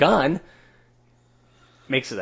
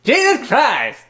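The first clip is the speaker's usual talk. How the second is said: blurted exclamation; 10 semitones higher, 4 dB louder, slower than usual. Real, not acted. Blurting a quick answer.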